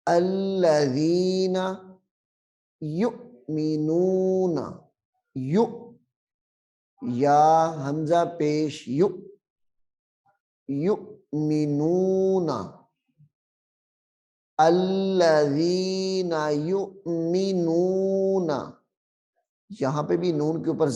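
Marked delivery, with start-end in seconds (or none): 0.1-4.6 یؤمنون with a clear catch on the ؤ; glottal stop on hamza. Shown as a demonstration. Teacher recites with the hamza catch. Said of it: "یہاں پہ جھٹکا دیں گے". 10.7-18.5 یؤمنون with a clear catch on the ؤ; glottal stop on hamza. Shown as a demonstration. Teacher recites with the hamza catch. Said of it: "جھٹکا دے کر پڑھتے ہیں".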